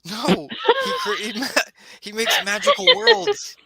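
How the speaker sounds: put-on very high-pitched excited kid, half laughing; Tony, thrilled. Game master as Tony defending his dungeon master, thrilled.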